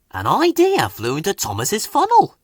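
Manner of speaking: plain reading voice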